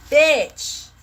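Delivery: loud sassy bitch; sharp sassy interjection; sassy aside. Real, not acted. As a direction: punctuate the reading with attitude. Sassy interjection after reading.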